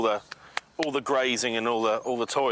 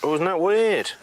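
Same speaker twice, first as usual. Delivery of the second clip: surprised; 5 semitones higher, 6 dB louder than usual. Real, not acted. Touching the rhino, surprised.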